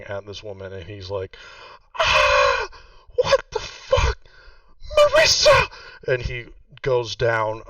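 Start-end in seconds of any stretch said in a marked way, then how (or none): 2.0-5.7 shrieking high panicked scream; screaming his head off. Acted. Sir Pox seeing the fallen woman, panicked screaming. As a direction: scream it in raw panic at the top of the voice. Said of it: "He is just screaming".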